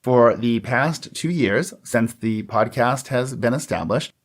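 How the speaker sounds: ordinary talk, nothing marked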